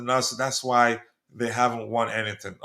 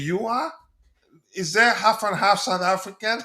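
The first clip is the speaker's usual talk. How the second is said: higher, laughing through the question; amused disbelief; 6 semitones higher than usual. Real, not acted. Host reacting to viewer claiming full South African, amused. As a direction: ask it half laughing, can't believe it.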